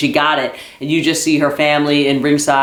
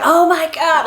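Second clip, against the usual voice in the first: mock scream; 13 semitones higher than usual. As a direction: squeal it like a retold scream, at normal volume. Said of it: "she was just screaming".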